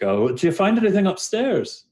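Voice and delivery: high, curious character voice; Dahlin. Acted. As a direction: ask in a high, playful character voice. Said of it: "he's, like, asking you".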